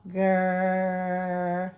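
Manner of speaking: "Grr." with the tongue pulled back; growled R sound. Shown as a demonstration. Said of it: "with your tongue back".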